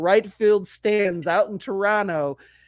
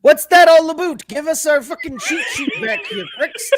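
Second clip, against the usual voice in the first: put-on laughing, high shouted Canadian accent; a Canadian fan yelling, half laughing; mock Canadian accent; 9 semitones higher, 7 dB louder than usual. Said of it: "with a Canadian accent this time".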